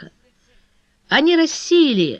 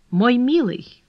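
cool, controlled endearment; cold retort; 3 semitones higher, slower than usual. A narrator's reading. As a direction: turn the endearment into a chilly rebuff. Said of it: "холодно возразила куртизанка".

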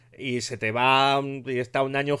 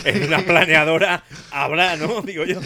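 laughing; 4 semitones higher, 3 dB louder than usual. Real, not acted. Joking about smugglers, laughing.

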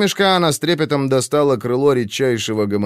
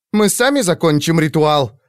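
defiant outburst; 6 semitones higher, 3 dB louder than usual. A narrator's reading.

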